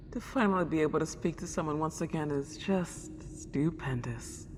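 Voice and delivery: delighted whisper. Acted. Lonely ghost whispers her joy at finally talking again. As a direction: hush it out in giddy joy. Ghost overjoyed to have company.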